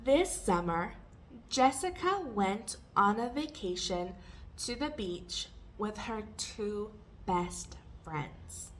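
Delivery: measured read-aloud for repetition; careful teacher's model reading. Shown as a demonstration. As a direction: read phrase by phrase, leaving gaps. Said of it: "read it more slowly and take pauses".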